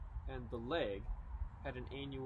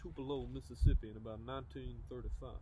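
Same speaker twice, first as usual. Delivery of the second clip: [name] impersonation; 15 dB louder than usual. Shown as a demonstration. [name] impersonator answers a lady at the Q and A, in [name] voice. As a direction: do the [name] voice for the crowd. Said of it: "how well you could do the voice".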